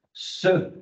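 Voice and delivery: "Ce" sounded alone as a long s with a short vowel; isolated S sound. Shown as a demonstration. Trainer isolating the sound for trainee teachers, "Ce" pronounced as a separate sound. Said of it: "vous entendez le son ce".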